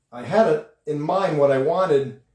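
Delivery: ordinary delivery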